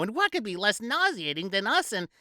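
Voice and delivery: high, sing-song lady voice; mock party lady. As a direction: tease like delivering a punchline. Woman delivers punchline.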